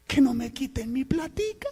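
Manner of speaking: high-pitched mock pleading; hammed-up frightened whine; fearful Gedeón.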